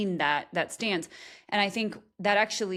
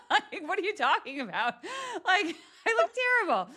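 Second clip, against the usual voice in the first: high, laughing protest; laughing disbelief; 12 semitones higher than usual. Real, not acted. Host laughing off a fake compliment. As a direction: talk through laughter, amused.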